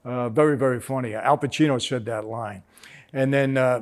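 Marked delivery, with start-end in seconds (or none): none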